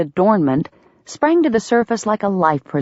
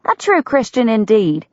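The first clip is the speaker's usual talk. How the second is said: "true" repeated, higher, sarcastic; mocking echo; 6 semitones higher than usual. A narrator's reading. Delia mocks his phrase.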